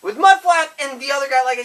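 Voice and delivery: passionately defending a beloved robot; fired-up rant. Real, not acted. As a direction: get worked up and insist hard.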